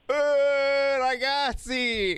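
drawn-out high, loud "ragazzi"; a big high-pitched hey guys; excited exclamation. Real, not acted. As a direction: cry out in delight. Host exclaims at mayor mention.

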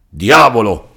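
alarmed lawyer exclaiming; startled exclamation. Acted. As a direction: jump at the news, eyes wide. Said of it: "esclamò il dottore spalancando gli occhi".

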